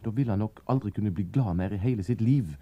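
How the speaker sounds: plain reading voice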